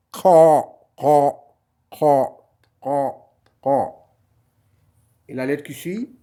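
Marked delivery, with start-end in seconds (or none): 0.1-3.9 "Qa" said loud, the k pushed to the back of the throat; deep-throat q. Shown as a demonstration. Teacher drilling students on the throaty "qa", loud and fading over the repeats. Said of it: "mais c'est au fond de la gorge".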